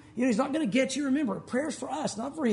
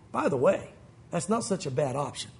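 usual speaking voice

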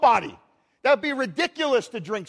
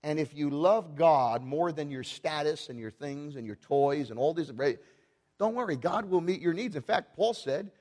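normal talking voice

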